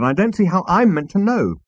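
high-pitched protest; exasperated. Acted. Arthur defending himself to Zaphod, exasperated. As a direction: insist helplessly.

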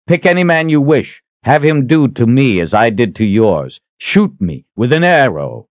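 bold, ringing hero's voice; defiant challenger. Acted. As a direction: call out the challenge to the whole crowd. Richard issues a challenge.

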